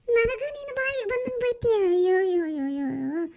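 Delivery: high wail sinking into moans; wailing lament.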